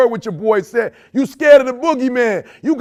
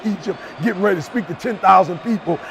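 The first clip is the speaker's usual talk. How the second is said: ordinary delivery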